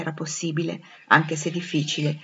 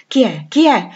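louder, agitated questioning, second one higher; urgent demand; 5 semitones higher, 9 dB louder than usual. Acted. Blind Rochester calling to an unknown presence, urgent demand. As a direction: demand an answer, rising in urgency.

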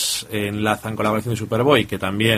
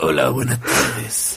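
deep mock newborn; 7 semitones lower, slower than usual. Baby voice gag.

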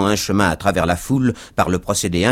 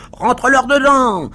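high, loud egging-on yell; exclaiming cabmen; 6 dB louder than usual. A narrator's reading. Cabmen urge the driver to attack, yelling. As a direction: cheer on the fighter at the top of your pitch. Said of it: "s'écrièrent cinq ou six cochers de voitures".

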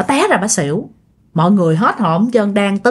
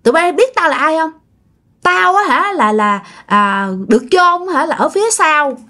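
possessed man's voice; 5 semitones higher than usual. Retold or imitated. Possessed neighbor taunting the mourners, raised gloating voice. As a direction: gloat like a spirit owning the murder. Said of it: "Cái bà mới nói lại là".

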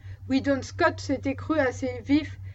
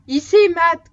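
loud, higher call across the yard; shout; 4 semitones higher, 10 dB louder than usual. A narrator's reading. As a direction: call Matt sharply to stop him. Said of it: "cria Scott". (crier fort pour arrêter Matt)

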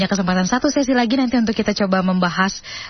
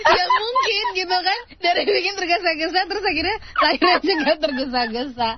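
speaking through giggles, higher; laughing; 9 semitones higher than usual. Real, not acted. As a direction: admit it while still laughing. Tertawa sambil mengaku. (bicara sambil tertawa geli)

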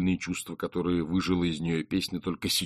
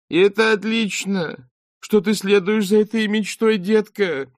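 put-on much higher, syrupy baby talk; Beril's high, sickly sweet sing-song; sugary cooing; 13 semitones higher, 8 dB louder than usual. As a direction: coo it like talking to a toddler. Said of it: "засюсюкала самым слащавым тоном".

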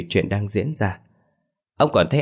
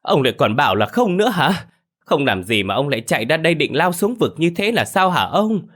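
much higher, louder accusing wail, slowing at the end; screaming wail; 10 semitones higher, 3 dB louder than usual. A narrator's reading. Bà Hảo wailing at Lâm before the neighbours, much higher and louder. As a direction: wail the accusation, dragging the last words. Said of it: "một lần nữa gào toáng cả lên".

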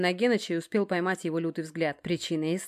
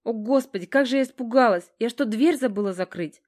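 higher-pitched, mildly tearful plaint; plaintive sobbing; 5 semitones higher, 5 dB louder than usual. A narrator's reading. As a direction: sound startled and close to sobbing. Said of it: "жалобно всхлипывает".

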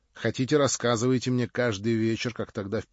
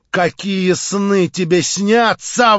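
louder, higher, slow bellow; 9 semitones higher, 12 dB louder, much slower than usual. A narrator's reading. Stavrogin bellows at Marya, slow and loud. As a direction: roar the question at her. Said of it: "возопил он".

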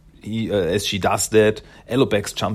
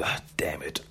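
hushed, voiceless mock cursing; half-whispered retold grumble; muttered curse; 6 semitones lower, 12 dB quieter than usual. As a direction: curse softly under your breath. Leo left behind inked, cursing.

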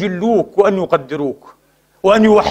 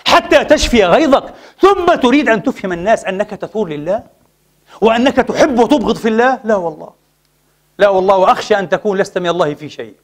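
usual speaking voice

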